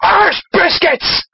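high, drawn-out Irish outburst; Father Jack. Acted. Reader channels drunken old priest, Irish outburst. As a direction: blurt it as a ranting Irish priest. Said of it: "Father Jack".

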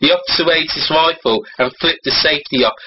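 ordinary delivery

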